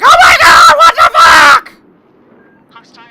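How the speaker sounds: high shrieking panic, imitating her fright; wife's screaming freakout, hammed up; mock terrified scream. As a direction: scream it like a jump scare got you. Girlfriend voiced, scared by shadow.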